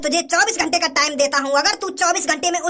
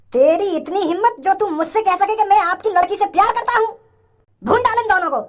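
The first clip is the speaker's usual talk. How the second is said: angry outburst; 5 semitones higher than usual. Acted. Father furious at the suitor.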